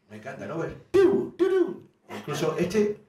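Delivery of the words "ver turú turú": high, loud electronic beep; imitated car alarm chirp; car lock beep.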